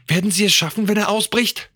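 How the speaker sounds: high-pitched, anxious question; growing fear. A narrator's reading. Son asking if they will escape, afraid. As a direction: let worry lift the voice. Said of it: "immer größere Angst bekam".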